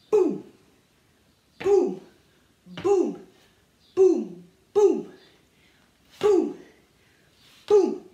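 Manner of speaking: boum punched loud; loud booms on the bass. Shown as a demonstration. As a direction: call out each bass hit. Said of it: "Et les basses".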